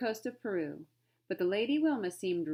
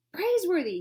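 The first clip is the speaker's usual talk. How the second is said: higher, louder eureka cry; exclaimed; 10 semitones higher, 8 dB louder than usual. Acted. Jack struck by an idea, high loud cry. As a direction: burst out with the idea. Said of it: "he exclaimed".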